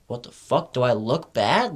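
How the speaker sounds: worried inner voice. Retold or imitated. Inner thought, stranger asks.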